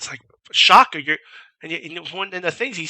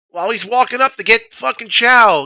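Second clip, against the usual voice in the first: ordinary delivery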